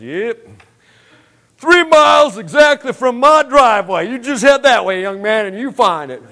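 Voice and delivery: high, loud, hearty country farmer voice; cheerful old farmer. Retold or imitated. The farmer on his tractor answering the lost driver, hearty and loud.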